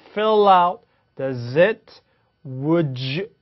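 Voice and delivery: "Fill out, does it, would you" each joined; linked pairs. Shown as a demonstration. Teacher summing up linking, "Fill out, does it, would you" joined. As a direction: link fil-lout, da-zit, wu-joo. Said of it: "그래서 연음된다".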